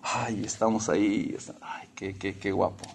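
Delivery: soft, dreamy self-flattery to the mirror; vain self-admiration. Retold or imitated. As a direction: coo at your reflection, lightly mocking. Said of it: "se ven en el espejo y dicen". (admirándose al espejo en voz suave)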